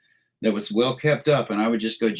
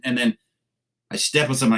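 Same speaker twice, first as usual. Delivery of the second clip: ordinary talk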